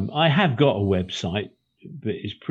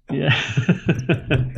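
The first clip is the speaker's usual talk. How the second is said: laughing yeah; 6 semitones higher than usual. Real, not acted. Laughing while saying goodbye.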